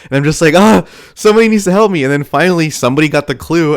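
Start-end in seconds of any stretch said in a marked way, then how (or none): 0.6-2.0 high, loud cry for help; mock desperate plea. Storyteller reliving his silent plea, high loud desperation. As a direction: call out in acted panic.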